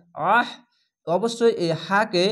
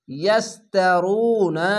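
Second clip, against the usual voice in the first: slow, drawn-out chanted word; Quran recitation; 4 dB louder, much slower than usual. Shown as a demonstration. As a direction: stretch each syllable like tajweed. (টেনে টেনে তিলাওয়াত)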